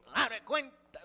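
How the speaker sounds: put-on loud northern Mexican accent, macho and emphatic. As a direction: punch "Palabra cuenta" with a norteño accent, loud and proud. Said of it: "el acento norteño". (acento norteño, fuerte y orgulloso)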